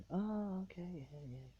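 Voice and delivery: high-pitched "oh, now I get it"; mock dawning understanding; sudden realization. Acts out classmates finally understanding.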